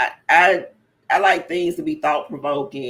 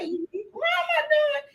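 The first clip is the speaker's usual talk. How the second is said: much higher, slow, stunned "what am I doing"; retold disbelief; 3 dB quieter, slower than usual. Guest reliving her own dismay at a vacuum sales interview. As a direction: voice the dismay of realizing it's a sales pitch.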